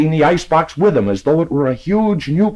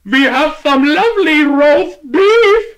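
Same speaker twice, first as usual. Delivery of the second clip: much higher, slow, louder clerk voice; deli man offering; 14 semitones higher, 6 dB louder, slower than usual. Acted. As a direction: drag it out for a deaf customer. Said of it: "said the delicatessen man".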